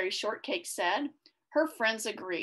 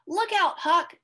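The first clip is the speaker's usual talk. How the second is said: high, loud playful warning; playful call; 9 semitones higher, 5 dB louder than usual. Acted. Ginger calling out to Huck in a snowball fight, high and loud. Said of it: "Ginger called playfully".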